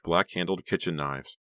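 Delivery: ordinary delivery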